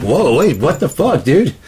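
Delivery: high loud whoa, wait; startled outburst. Retold or imitated. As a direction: react like the boat just rocked under you. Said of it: "he's like".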